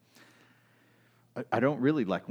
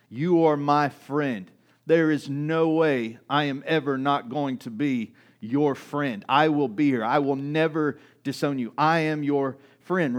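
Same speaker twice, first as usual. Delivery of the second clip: loud, slower heartfelt vow of friendship; protective loyalty; 4 semitones higher, 8 dB louder, slower than usual. Retold or imitated. Peter vowing loyalty to Jesus, loud and heartfelt. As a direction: swear loyalty with your whole chest. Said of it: "heartfelt moment of protective loyalty".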